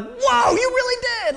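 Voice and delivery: holy cow disbelief; stunned. Retold or imitated. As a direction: blurt it out in shock. Disciples stunned seeing Jesus.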